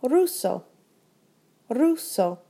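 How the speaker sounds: ordinary talk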